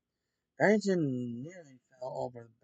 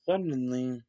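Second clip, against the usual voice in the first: plain reading voice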